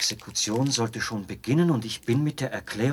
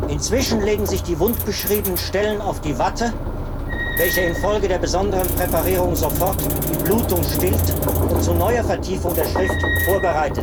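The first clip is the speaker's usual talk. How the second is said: loud and much higher, straining over the machine's noise; shouting over machinery; 8 semitones higher, 7 dB louder than usual. Acted. Officer proudly explains the running machine to the traveler, shouting over the noise. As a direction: shout every explanation over the roaring apparatus.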